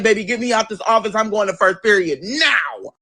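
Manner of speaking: high, fast sassy quote; mock sassy dismissal; sassy retort. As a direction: brush him off, sassy. Retelling telling principal off.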